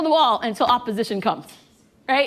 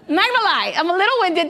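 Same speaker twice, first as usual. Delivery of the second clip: laughing while winded; 3 semitones higher, faster than usual. Real, not acted.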